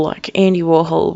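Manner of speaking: ordinary talk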